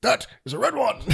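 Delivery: high, loud hammed-up alarm; Venom. Co-host quoting Venom's reaction to Carnage, high hammed-up cry. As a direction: cry it out high, playing the alien. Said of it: "And then he's like".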